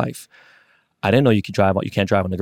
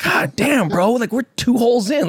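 much higher, squeaky disbelief; exasperated, voice climbing way up; incredulous squeal; 13 semitones higher, slower than usual. Retold or imitated. His incredulous reaction.

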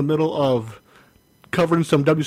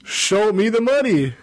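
half-hearted yell; 6 semitones higher than usual. Shown as a demonstration. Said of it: "you gotta yell that".

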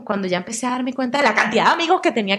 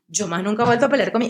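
usual speaking voice

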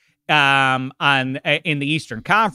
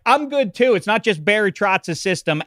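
mock quote; 6 semitones higher than usual. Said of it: "Matt Barzell's like".

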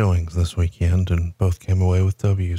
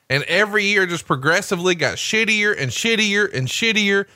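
much higher ranting promo voice; wrestling promo rant; 9 semitones higher than usual. Acted. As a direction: cut a furious old-school promo. Mock angry wrestling promo rant.

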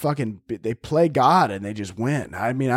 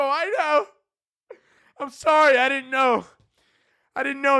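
high, teary pleading; mock sobbing apology; 11 semitones higher than usual. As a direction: whimper sorry like a kid. Crying apology to guard.

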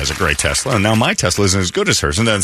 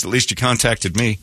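usual speaking voice

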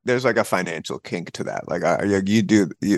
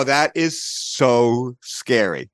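ordinary delivery

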